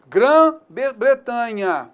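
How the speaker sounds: "Grã-Bretanha" stretched very slowly, syllable by syllable; slow spelled-out example. Shown as a demonstration. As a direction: drag out "Grã-Bretanha" for the class. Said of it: "o que eu tenho que lembrar?". ("Grã-Bretanha" bem arrastado, sílaba por sílaba)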